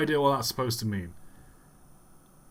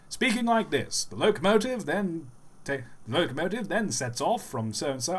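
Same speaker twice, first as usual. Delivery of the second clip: high, swooping parody voiceover; old documentary narrator; 7 semitones higher than usual. Shown as a demonstration. Streamer demonstrating an old train documentary voice, parody narration. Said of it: "there's a certain voice".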